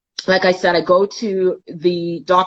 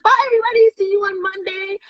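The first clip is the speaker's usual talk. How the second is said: bright, much higher singsong farewell; cheerful goodbye to the hallway, much higher; 12 semitones higher, faster than usual. Retold or imitated. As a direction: call out a happy goodbye, pitch up high. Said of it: "And I was happy".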